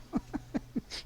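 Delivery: soft, high breathy chuckle; quietly tickled giggle; amused laugh. Real, not acted. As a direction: laugh along softly. Amused, says Merlin is laughing.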